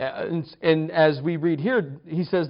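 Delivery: usual speaking voice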